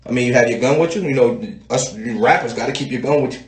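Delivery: a hint of a smooth host; sly interviewer. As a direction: slip it in offhand. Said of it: "he slip in a quick little".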